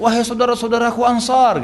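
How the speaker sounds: high-pitched rallying call; retold shout. Bilal calls the passing Ansar for help, shouting. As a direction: call out to rally your companions. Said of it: "Bilal teriak".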